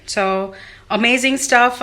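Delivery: ordinary talk, nothing marked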